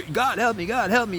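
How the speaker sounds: high-pitched plea at ordinary volume; mock screaming out. Aries hanging off a cliff, mock screaming for help. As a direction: call for help in acted, rising panic. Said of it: "You're, like, screaming out".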